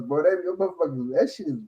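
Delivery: ordinary delivery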